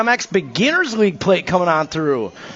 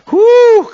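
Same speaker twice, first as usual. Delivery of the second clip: excited whoop; 10 dB louder than usual. Real, not acted. Exciting battle on the straightaway.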